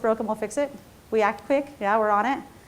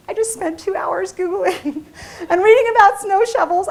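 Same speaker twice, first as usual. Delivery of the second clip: plea to husband, teary laugh, higher and slow; hurt new wife; 7 semitones higher, 3 dB louder, slower than usual. Retold or imitated. New wife pleads with husband over returned shovel, teary laugh. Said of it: "devastated, right?".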